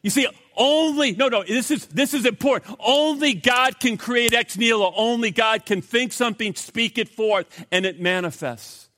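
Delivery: emphatic preaching. Real, not acted. Pastor stressing that only God creates, emphatic. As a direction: press it home hard. Emphatic point after joke.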